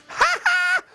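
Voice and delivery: squeaky two-note cry; high-pitched cry. Acted. Stunned Stan seeing the baby, high squeaky yelps. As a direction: yelp twice, very high.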